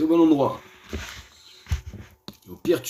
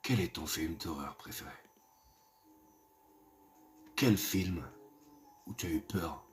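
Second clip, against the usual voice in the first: much softer, lower, creepy storyteller; spooky hushed voice; 5 semitones lower, 10 dB quieter than usual. Acted. Asking viewers their greatest fear in a soft, eerie hush. Asks viewers about horror films.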